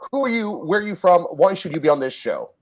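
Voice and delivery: ordinary delivery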